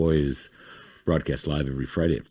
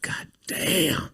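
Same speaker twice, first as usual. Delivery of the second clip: imitating an awed, whispery gasp of words; high, whispered mock amazement; hushed swooning reaction; 13 semitones higher than usual. Acts out her awed reaction.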